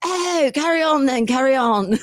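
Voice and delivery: put-on high-pitched British old-lady voice; airy, hammed-up English accent; theatrical English accent. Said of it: "British old lady".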